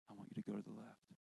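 very soft, low voice of God; hushed, tender assurance; God's voice. Retold or imitated. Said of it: "that voice say again".

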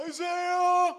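high, loud call up a hill; shouted name. Retold or imitated. Dad calling his son down from the hilltop, a loud shout. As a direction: yell up to the top of the hill. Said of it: "I yell to the top of the hill".

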